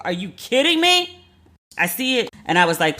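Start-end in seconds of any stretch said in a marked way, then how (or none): 0.0-1.2 much higher, stretched-out "are you kidding me"; incredulous. Retold or imitated. Reacting to the email of the woman who kicked her out, outraged high disbelief.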